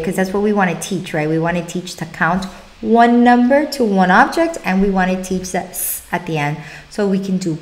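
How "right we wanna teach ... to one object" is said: ordinary delivery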